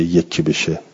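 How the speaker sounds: usual speaking voice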